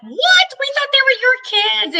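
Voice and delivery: high, loud astonished "What?"; surprised exclamation. Retold or imitated. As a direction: gasp it out in disbelief. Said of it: "And they're like".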